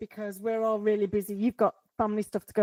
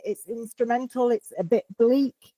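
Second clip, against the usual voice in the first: usual speaking voice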